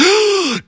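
high startled gasp; mock horrified kid gasp; child's gasp. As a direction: gasp like a shocked little boy. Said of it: "A little boy looked up and went".

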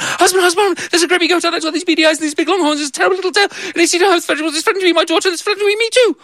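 very high frantic voice; breathless rush of panic; panicked wife. Acted. Wife running to her husband about the goat, high and frantic.

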